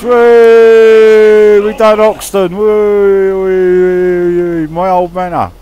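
loud, high cheering whoops; shouting with glee after the win; victory whoops. Real, not acted. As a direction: celebrate the win like a football chant. Celebrating a win in the game.

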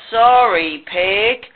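mildly sheepish, stretched-out sorry; lightly voiced sheepish sheep; sheepish apology. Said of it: "said Sheep sheepishly".

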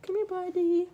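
high-pitched coaxing of a cat; cutesy high voice for an animal; pet talk. Real, not acted. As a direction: coo to your pet way up high. Talking to the cat.

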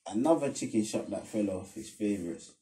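usual speaking voice

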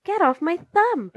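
high, loud yell of protest; little man's high-pitched holler. A narrator's reading. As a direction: yell it out as if hurt. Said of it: "yelled the funny little man".

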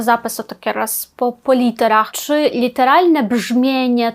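ordinary talk, nothing marked

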